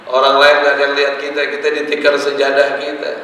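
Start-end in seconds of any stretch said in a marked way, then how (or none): none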